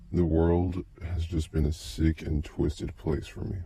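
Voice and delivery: plain reading voice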